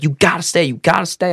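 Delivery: high-pitched insistent urging; retold pleading insistence, repeated; adamant. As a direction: imitate someone who won't take no, pitch up. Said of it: "She's, like, so adamant".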